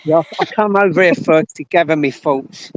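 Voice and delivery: normal talking voice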